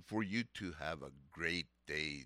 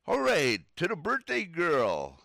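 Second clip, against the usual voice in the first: loud, high birthday cheer; excited; 10 semitones higher, 9 dB louder than usual. Real, not acted. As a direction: announce it with big excitement. Radio birthday shout-out.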